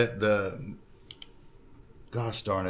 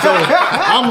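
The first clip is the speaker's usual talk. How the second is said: laughing; 10 semitones higher, 6 dB louder than usual. Real, not acted. Laughing while telling story.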